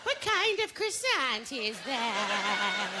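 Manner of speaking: put-on slow, drawn-out old lady voice; fussy senior. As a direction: dawdle over each question. Senior ordering croissant.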